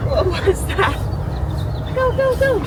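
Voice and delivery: high, excited urging; excited dog talk. Real, not acted. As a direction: hype up the dog.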